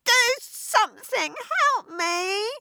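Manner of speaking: high, crying, slow plea; self-pitying sobs. A narrator's reading. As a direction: sob and beg for help. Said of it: "subsided into self-pitying sobs".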